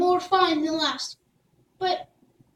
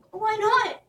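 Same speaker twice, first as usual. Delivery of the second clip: shaking; 7 semitones higher than usual. Acted. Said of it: "asked Jack, shaking".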